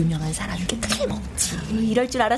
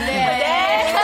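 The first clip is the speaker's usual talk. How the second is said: loud answer; 4 semitones higher, 4 dB louder than usual. Acted. Class answers loudly.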